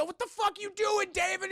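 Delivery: mock shouted telling-off; retold angry yell. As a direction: demand it, high and exasperated. Retold quarrel after window smash.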